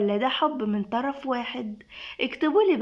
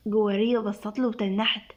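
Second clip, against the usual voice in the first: ordinary delivery, nothing marked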